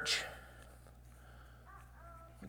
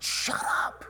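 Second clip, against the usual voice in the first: hushed rebuke. Retold or imitated. Crowd silencing the blind beggar.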